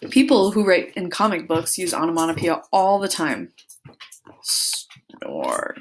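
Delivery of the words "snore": deep, low snore; snoring sound. Shown as a demonstration. Teacher snoring to act out comic sounds.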